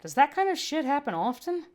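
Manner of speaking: annoyed medic asking. Acted. Medtech voice.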